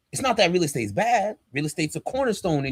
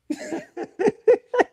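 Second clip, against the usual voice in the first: high laugh. Real, not acted. Laughs at own joke.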